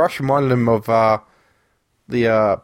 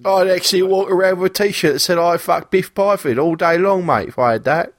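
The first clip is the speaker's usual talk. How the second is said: higher, hammed-up English lad accent; 4 semitones higher than usual. Boasting about a Biff T-shirt, in a hammed-up English lad accent. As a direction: brag like a rowdy metal fan. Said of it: "if I'm talking like this".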